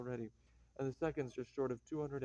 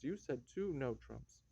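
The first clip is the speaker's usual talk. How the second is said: ordinary delivery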